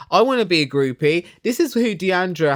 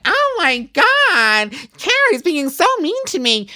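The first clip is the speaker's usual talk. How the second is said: mocking Deandra; 11 semitones higher, 3 dB louder than usual. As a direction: wail it like a hurt child. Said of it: "Deandra's like".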